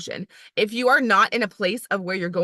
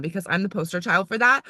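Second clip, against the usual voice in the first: ordinary talk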